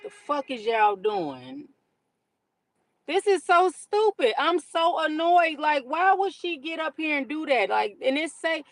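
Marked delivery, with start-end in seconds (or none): 0.0-7.3 high, loud, fed-up yelling at the road; furious driver shouting in the car; exasperated rant. Real, not acted. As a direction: vent at the idiot driver ahead. Ranting at a bad driver.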